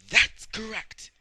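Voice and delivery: high, very loud drawn-out cry; shouted interjection. Acted. Villain breaking into the chorus, shouted high. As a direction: bellow it high and stretch it out.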